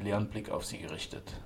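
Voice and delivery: usual speaking voice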